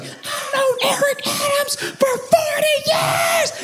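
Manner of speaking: very high, slow mock screech; hammed-up scream, every word stretched; imitated screaming. Lady speaking for the mayor, shouting everybody down in mock screams. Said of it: "she's just screaming, screaming, right?".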